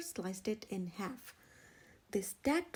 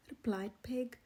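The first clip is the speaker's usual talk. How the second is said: plain reading voice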